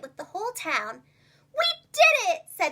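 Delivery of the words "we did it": higher, louder victory cheer; joyful cheer. A narrator's reading. The friends win the town prize, joyful cheer.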